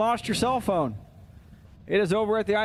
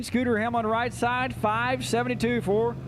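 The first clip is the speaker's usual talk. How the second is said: usual speaking voice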